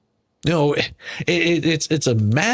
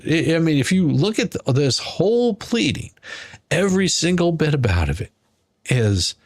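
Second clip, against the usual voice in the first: ordinary delivery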